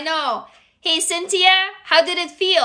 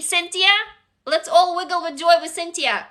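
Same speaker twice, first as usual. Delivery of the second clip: ordinary talk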